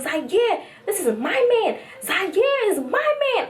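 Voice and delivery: high, emphatic mock clinginess; possessive gushing. Possessive gushing at boyfriend, acted.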